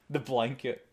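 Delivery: ordinary delivery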